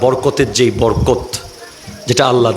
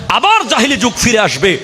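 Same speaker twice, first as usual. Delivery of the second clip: high-pitched fiery sermon delivery; impassioned hellfire preaching; full-force preaching; 10 semitones higher than usual. Real, not acted. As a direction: warn the congregation with rising, ringing intensity.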